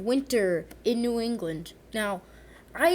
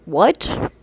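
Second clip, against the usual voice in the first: loud, rising disbelieving what; shocked what; 5 semitones lower, 12 dB louder than usual. Retold or imitated. Reacting to the wheelchair arriving, loud stunned disbelief. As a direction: blurt it out in sheer disbelief.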